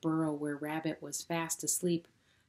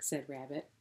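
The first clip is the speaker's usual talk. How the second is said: plain reading voice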